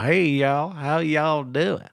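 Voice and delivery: high, slow Tennessee drawl on the greeting; Southern accent. Retold or imitated. Imitating a Tennessee coworker's thick Southern accent. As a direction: stretch it out like a good old Southern boy. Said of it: "he sounded like he was from fucking Tennessee".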